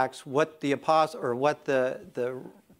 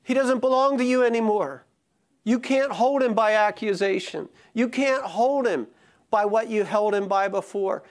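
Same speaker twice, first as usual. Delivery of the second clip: preaching at full force; 8 semitones higher, 5 dB louder than usual. Acted. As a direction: build the sermon to its peak with each phrase.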